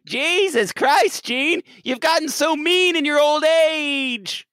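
high-pitched, drawn-out dismay; mock shock. Host scolds the creator for meanness, mock-shocked. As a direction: wail it in hammed-up horror.

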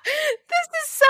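high, slow, helpless laughter; real giggling fit; laughing. Real, not acted. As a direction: get the words out through a laugh. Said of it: "I'm laughing because this is".

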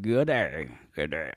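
attempted hillbilly Australian drawl; hillbilly Australian accent. Podcaster mimicking an actor's movie accent, half-successful hillbilly Australian. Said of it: "like, hillbilly Australian accent in that movie".

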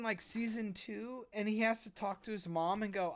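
ordinary talk, nothing marked

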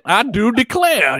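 high, slow Southern drawl; hammed-up genteel Southern accent; mock Southern accent. Mocking the coach's Southern put-on.

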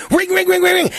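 high, loud "Ring, ring" shouted in desperation; mock shouted ringing. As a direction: yell the bell like a patient in pain. Screaming patient ringing bell.

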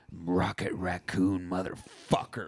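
low, slow Bond parody; James Bond-style introduction. Retold or imitated. Co-host parodies Rocket giving his Bond line, low slow suave introduction. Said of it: "he gets his Bond name".

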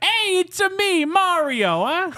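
high, drawn-out cartoon Italian accent; Mario. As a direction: shout it as a hammed-up Italian game hero. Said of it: "Super Mario, where he's like".

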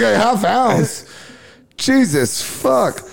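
high, slow, amazed repeat of a half ounce; shocked at how much he asked for; stunned disbelief. Real, not acted.

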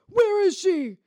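loud, high gravelly demand; hammed-up Batman-style interrogation yell; faked gravel shout. Shown as a demonstration. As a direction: force it out hard with fake gravel. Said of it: "an easy way to fake a gravel".

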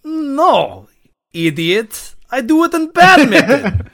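high, loud indignant retort; exasperated mock outburst; mock offended. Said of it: "looked at me almost offended".

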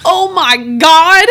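high, loud, slow, voice breaking into crying; tearful shock. Retold or imitated. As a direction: gasp it out as the tears hit. Retelling breaking down in tears.

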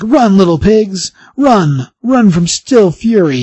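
slow mocking villain voice; villain taunt. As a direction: savour each word like a cartoon baddie. Villain taunts fleeing crowd.

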